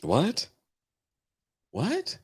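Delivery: high disbelieving "What?" rising; stunned rising what; incredulous what. Real, not acted. Reacting to storyline.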